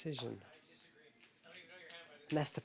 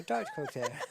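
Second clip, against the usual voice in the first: laughing; 8 semitones higher than usual. Real, not acted. Laughing through Irish-accent joke.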